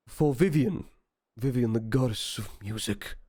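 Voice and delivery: lightly voiced church priest; a hint of priestly reverence. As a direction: intone it a little like a priest. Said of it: "almost like a church priest almost".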